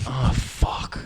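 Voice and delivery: breathy whispered oh fuck; whispered curse. Retold or imitated. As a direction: whisper it under your breath. Bear voiced, whispered.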